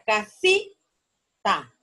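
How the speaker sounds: "casita" slowly split, ca-SI-ta, with SI held; stress on SI. Shown as a demonstration. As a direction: lean hard on SI in "casita", then a separate ta. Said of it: "estaría en ti".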